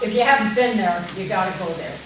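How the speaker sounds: usual speaking voice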